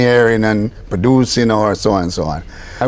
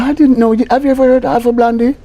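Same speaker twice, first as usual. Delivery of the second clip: imitating a surprised lady, pitched way up; a high, lightly voiced lady asking in disbelief; British lady; 12 semitones higher than usual. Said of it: "she said".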